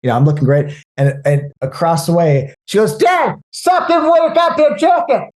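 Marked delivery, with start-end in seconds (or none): 3.0-5.3 high put-on yell at dad; scolding daughter. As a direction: call across the room at your dad.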